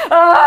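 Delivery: a sudden loud high yelp; breasts crying out. Retold or imitated. Telling the audience her breasts cried out, a loud yelp. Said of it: "my breasts would cry out".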